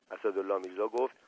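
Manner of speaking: plain reading voice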